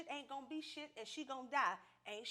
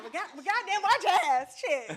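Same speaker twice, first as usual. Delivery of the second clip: mock yell; 6 semitones higher, 13 dB louder than usual. Retold outburst.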